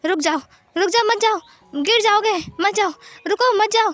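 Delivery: high, pleading cries to stop; urgent warning. Acted. Wang ji in a dream to man on roof edge, urgent warning. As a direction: call out desperately to halt someone in danger.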